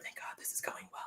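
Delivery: unvoiced sarcastic whisper; whispered relief. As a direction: breathe it out under your breath.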